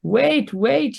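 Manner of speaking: high pleading cry; imploring. A narrator's reading. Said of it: "he implores".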